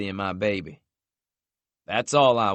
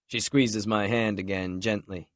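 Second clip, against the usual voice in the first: ordinary delivery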